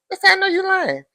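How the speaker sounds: high, loud sassy call-out; calling out a lie. Real, not acted. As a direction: point the finger, pitch way up. Speaker calls out a lie.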